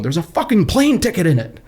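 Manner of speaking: high-pitched mock yell, little added volume; retold yell. Wife to husband at work about plane ticket, mimicked yelling. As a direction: imitate an exasperated yell down the phone. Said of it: "she just yells into the phone".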